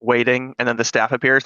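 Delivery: ordinary delivery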